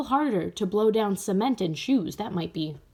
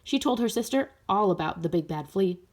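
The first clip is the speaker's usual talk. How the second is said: plain reading voice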